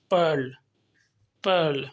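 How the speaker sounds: "पर्ल" with a long er and the tongue slightly curled; "पर्ल" said slowly, with a held er sound; long er vowel. Shown as a demonstration. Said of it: "टंग को थोड़ा सा twist किया जाता है".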